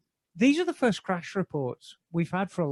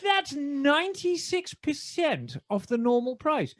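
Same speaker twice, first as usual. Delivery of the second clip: high, loud, excited BBC pitchman; 8 semitones higher, 4 dB louder than usual. Acted. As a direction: pitch the bargain in a heightened BBC British. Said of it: "in my best B B C voice".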